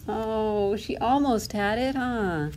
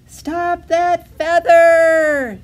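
high shout, words stretched long; loud quacking cry; 9 semitones higher, 11 dB louder, much slower than usual. A narrator's reading. Duckling chasing her runaway feather, loud cry. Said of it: "she quacked loudly".

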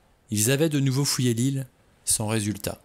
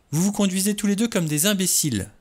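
higher, exasperated scolding; scornful rebuke; 10 semitones higher, 3 dB louder than usual. A narrator's reading. Vera scolding the two arguing men, exasperated and higher. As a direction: tell them off like fools.

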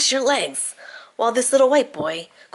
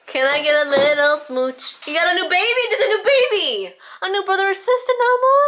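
much higher, gushing; 9 semitones higher than usual. Real, not acted. Mother gushing excitedly to her baby about a new sibling, excited baby talk.

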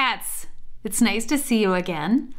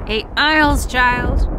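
croaking; 4 semitones higher, 7 dB louder than usual. Acted. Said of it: "Grandma croaked".

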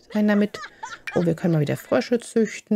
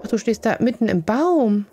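ordinary talk, nothing marked

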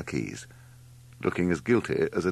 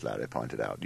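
ordinary delivery, nothing marked

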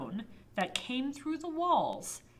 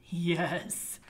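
spooky whisper; 5 semitones lower, much slower than usual. Acted. Nasties answer from the dark.

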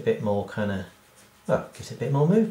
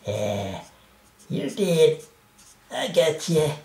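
cackle, then high cartoon taunt; hammed-up villain cat voice; triumphant attack cat; 5 semitones higher, 3 dB louder, slower than usual. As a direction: gloat as a cat landing on its prey. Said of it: "he would kinda be like".

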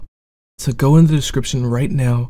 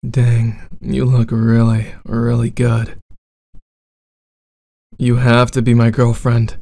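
bad boy roleplay.

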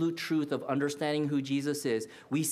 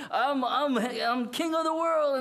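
mock triumphant boast, much higher; boastful pride; 9 semitones higher, 4 dB louder than usual. Peter thinking he finally got it right, hammed-up triumphant boast. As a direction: crow it like he's on top. Peter's imagined proud thought.

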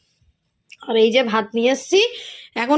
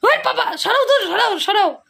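put-on high, laughing child's whine pushing dad away; mock childish whine, much higher; imitating sleepy daughter; 8 semitones higher than usual. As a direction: play the little girl shoving her father off in her sleep, giggling. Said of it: "বাবাকে এরকম".

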